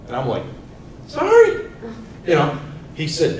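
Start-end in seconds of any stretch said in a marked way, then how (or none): 1.1-1.7 squeaky, much higher sorry; child apologizing to grandpa, squeaky and much higher; sheepish sorry. Retold or imitated. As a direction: apologize like a startled kid. Child apologizing after scolding.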